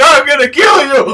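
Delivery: high, loud threatening yell; mock furious yell. Quoting hooligans in snowball fight.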